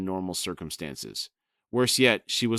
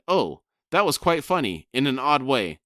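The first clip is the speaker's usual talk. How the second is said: high, delighted little exclamations; amused delight; 5 semitones higher, 5 dB louder than usual. Acted. Lady Envy amused at the house entrance, high and tickled. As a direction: lift it up, tickled and surprised.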